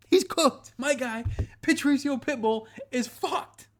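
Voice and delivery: high, loud, slowed-down cry of disbelief; excited outburst. Real, not acted. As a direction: blurt it out like you can't believe it. Mocking outburst about a fighter.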